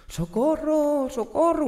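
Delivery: high, slow, drawn-out cries of help; desperate cries for help. Acted. Trapped people in the fire crying out for help. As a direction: wail for help from the window. People calling from burning building.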